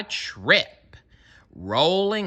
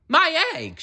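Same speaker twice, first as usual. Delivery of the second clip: very high excited cry; shouted. A narrator's reading. Horton seeing his egg hatch, high excited shout. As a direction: cry out far higher in joy. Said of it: "shouted Horton".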